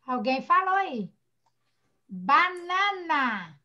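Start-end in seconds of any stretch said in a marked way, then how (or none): 2.1-3.6 BANANA stretched very slowly, syllable by syllable. Shown as a demonstration. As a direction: draw out each syllable of BANANA. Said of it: "fica banana". (banana bem devagar, sílaba por sílaba)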